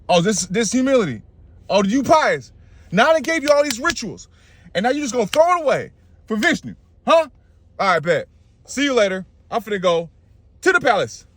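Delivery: high-pitched mock scolding; indignant adviser. Shukra scolds Bali and storms off.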